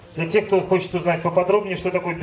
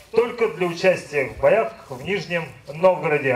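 ordinary talk

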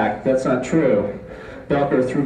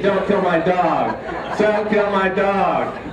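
put-on high, slow, tearful child begging; boy crying to save his dog, high and slow; crying plea; 5 semitones higher, slower than usual. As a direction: beg like a sobbing boy. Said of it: "tears, snot, screaming".

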